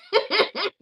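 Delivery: short, high burst of laughter. Real, not acted. Streamer amused after reading out a comment, short high laugh. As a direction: break into a quick high giggle.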